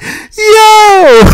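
loud high yell; shouted yo. Real, not acted. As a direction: belt out the yo. Sign-off exclamation.

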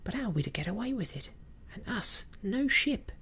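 high-pitched pirate, climbing in protest; objecting mutineer. A narrator's reading. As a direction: challenge them, pitch rising to the end. George challenges the others in story.

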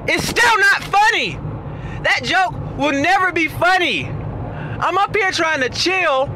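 unload on the person telling the stale joke; passionate rant. Pretend. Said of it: "But I was passionate about that".

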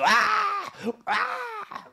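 high, loud mock battle cry; war cry. Army shouting across the valley, mock war cry. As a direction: let out a big shrill army yell. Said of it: "they shouted".

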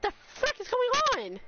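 high, rising outburst; high-pitched, overwhelmed exclamation; exasperated shock. Real, not acted. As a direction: cry it out in disbelief. Overwhelmed by gifted subs.

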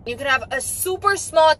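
ordinary delivery

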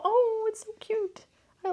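high, gushing squeal over the card. Real, not acted. Reader squealing over a cute tarot card, gushing. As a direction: melt at how cute it is.

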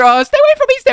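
high-pitched mock alarm; playful panic. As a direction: back off in hammed-up fright. Acted panic at shape-shifting pursuer.

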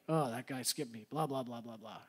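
low, soft grumble trailing into blahs; mock complaining. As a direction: imitate your own petty griping, low and soft. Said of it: "I complain".

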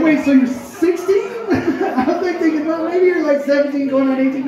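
high-pitched incredulous questioning; retold astonishment, voice jumping up; disbelief. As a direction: react in shocked disbelief, reaching for a better answer. Learns passenger is a minor.

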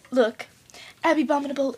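ordinary delivery

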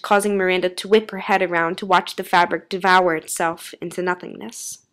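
plain reading voice

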